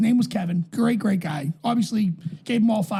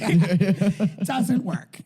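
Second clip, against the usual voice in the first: mock Mexican accent; much slower than usual. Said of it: "he's Mexican".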